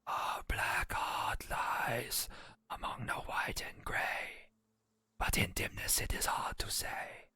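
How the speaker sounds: slow, breathless ghostly whisper from nowhere; eerie disembodied whisper. Acted. The glowing bones speak to the divination student, ghostly whisper. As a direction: breathe the prophecy like a spirit in the smoke. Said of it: "and a disembodied voice, coming from nowhere in particular, speaks out".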